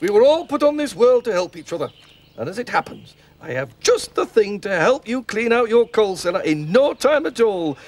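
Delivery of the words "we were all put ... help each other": ordinary delivery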